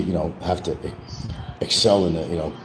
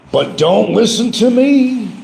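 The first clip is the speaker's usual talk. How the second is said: very high, loud, drawn-out singsong; sing-song catchphrase; 6 dB louder, slower than usual. Pretend. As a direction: belt it high and hold the last word. Repeats mock catchphrase.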